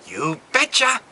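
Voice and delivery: eager folksy reply. Retold or imitated. Expected reply to the patriots' greeting, chirped in mockery. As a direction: chirp it like a cheerful good citizen. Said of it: "To this you were expected to say".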